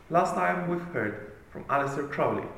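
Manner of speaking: ordinary talk, nothing marked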